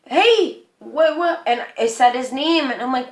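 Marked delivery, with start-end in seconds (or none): 0.1-1.4 high, louder startled call; acted-out worried hail; alarmed calling out. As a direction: call after him in confusion. Calling after the manager.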